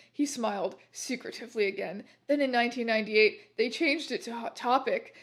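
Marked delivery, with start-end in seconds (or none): none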